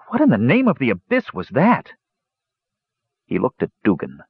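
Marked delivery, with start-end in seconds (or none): 0.1-1.9 high-pitched, startled question; astonished. A narrator's reading. Young mage staring after the flying thing, astonished. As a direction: demand to know in sheer disbelief. Shocked after creature passes overhead.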